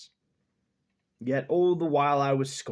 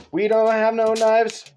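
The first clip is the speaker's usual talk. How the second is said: mock alarm; 9 semitones higher, 13 dB louder than usual. Real, not acted. Loud mock alarm in kitchen.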